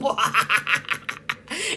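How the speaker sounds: short real laugh at her own joke; a quick amused chuckle; laughing at her own bit, amused chuckle. Real, not acted.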